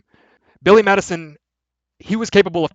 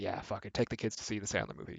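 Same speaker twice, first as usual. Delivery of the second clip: much softer, lazy shrug of a voice; careless parents; 5 semitones lower, 16 dB quieter than usual. Retold or imitated. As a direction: toss it off like a tired parent giving in. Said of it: "the parents are gonna be like".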